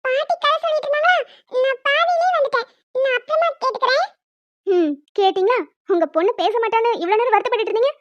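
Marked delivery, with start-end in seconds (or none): 0.0-4.1 put-on high small child's voice; little girl. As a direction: answer like a little daughter calling out to mum. Daughter refuses father. (அம்மாவிடம் பதில் சொல்லும் சிறுமியின் உயர்ந்த குரல்)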